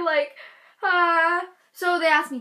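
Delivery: ordinary talk, nothing marked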